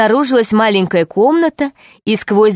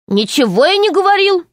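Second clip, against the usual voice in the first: indignant denial; 10 semitones higher than usual. A narrator's reading. Garold denies, pitched high.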